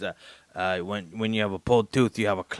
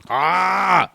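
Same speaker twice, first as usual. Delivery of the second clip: high, loud "ah" outburst; uncontrollable yell; 10 semitones higher, 9 dB louder than usual. Acted. Patient proving the yelling is uncontrollable, high loud yell. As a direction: let a sudden yell burst out. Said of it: "See, it's uncontrollable".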